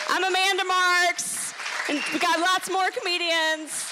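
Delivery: bright club emcee outro; emcee announcement. Real, not acted. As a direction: hype up the crowd for the next act. Host announcing next act.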